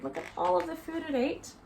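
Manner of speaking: ordinary talk, nothing marked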